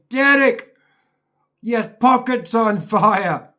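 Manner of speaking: high, loud warning call, slowing on the fire. Retold or imitated. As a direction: shout it across the driveway. Someone calling out to him.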